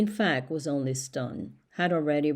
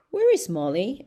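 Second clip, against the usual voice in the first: higher and louder, a sudden alarmed cry; alarmed exclamation; 5 semitones higher, 6 dB louder than usual. A narrator's reading. An animal cries out in alarm when Molly is missing, louder and higher. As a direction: call out in alarm for the missing mare. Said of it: "exclaimed somebody".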